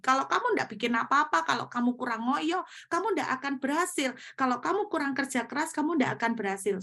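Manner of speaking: accusing devil. Retold or imitated. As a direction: taunt like the accuser stirring panic. Preacher voices devil's accusations.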